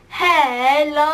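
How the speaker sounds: long drawn-out hello; exaggerated elongated greeting; Marcus Butler impression. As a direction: stretch both syllables right out. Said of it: "Marcus Butler".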